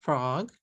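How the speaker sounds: "frog" in one beat with a small rise; English FROG, f and r blended; one-syllable English. Shown as a demonstration. Teacher contrasting with katakana, one-syllable English. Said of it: "a one syllable word".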